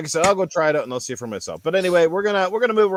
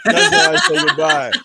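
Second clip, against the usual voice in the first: laughing; 12 semitones higher, 8 dB louder than usual. Acted. [name] laughs at own joke.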